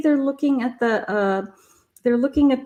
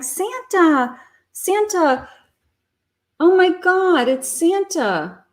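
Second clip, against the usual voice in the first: put-on excited kids; 7 semitones higher, slower than usual. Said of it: "they're like".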